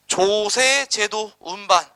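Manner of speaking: drawn out and slow, each syllable weighted; slow emphatic answer. Shown as a demonstration. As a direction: spell out the answer slowly for students. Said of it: "무슨 제도 운반이더라?". (천천히 힘주어 또박또박)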